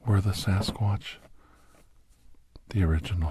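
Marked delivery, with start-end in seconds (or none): none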